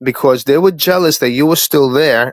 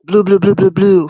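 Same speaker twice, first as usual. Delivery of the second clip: mock nagging; 8 semitones higher, 4 dB louder than usual. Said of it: "and they start telling you".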